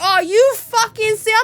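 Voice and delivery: high-pitched incredulous outburst; indignant disbelief. Retold or imitated. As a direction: retell it as an outraged, high-voiced protest. Acted protest to the cop.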